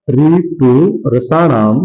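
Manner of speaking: very slow, stretched syllables; slow dictation. Shown as a demonstration. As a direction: draw out each word for writing down. Teacher repeats the sutra for dictation. (बहुत धीरे, खींचकर)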